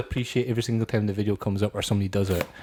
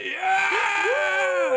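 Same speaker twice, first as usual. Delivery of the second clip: high drawn-out yell; shout; 3 dB louder than usual. Retold or imitated. Dad at the back of the plane yells his answer. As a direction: bellow it across the plane. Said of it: "He's like".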